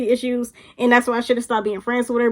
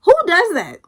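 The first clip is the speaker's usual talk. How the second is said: exasperated disbelief; 4 dB louder than usual. Real, not acted. Reacting to friend embarrassing others, exasperated. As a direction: ask it incredulously, then spell out the answer.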